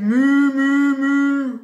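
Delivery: very loud, sustained moos; cow moos. A narrator's reading. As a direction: moo big and long like a cow. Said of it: "the duck on the pond said".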